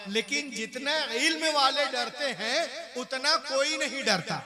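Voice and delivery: loud, fervent sermon delivery; fiery pulpit preaching; preaching at full force. Acted. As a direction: rouse the congregation.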